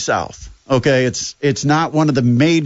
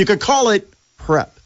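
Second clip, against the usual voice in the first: wry, knowing sarcasm; sarcastic; 6 semitones higher than usual. Acted. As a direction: say it with a wink. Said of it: "That's, that's sarcasm too".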